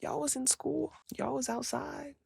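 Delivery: hushed, breathy aside; mock whisper. Whispering to teammates about being young. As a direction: whisper it like a shocked secret.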